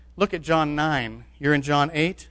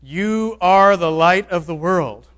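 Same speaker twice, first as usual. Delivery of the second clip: slow, louder, weighty proclamation; Jesus proclaiming; 3 semitones higher, 7 dB louder, slower than usual. Retold or imitated. Jesus to his fishermen, a slow, louder proclamation. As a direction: give each word weight as Jesus sending his men. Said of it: "Jesus ... and said".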